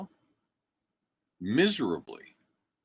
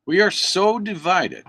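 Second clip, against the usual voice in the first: higher and louder, We are so divided; a raised, emphatic We are so divided; emphatic declaration; 8 semitones higher, 5 dB louder than usual. Real, not acted. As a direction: state it hard the first time. Repeats it for emphasis.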